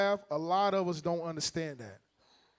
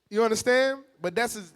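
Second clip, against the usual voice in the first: normal talking voice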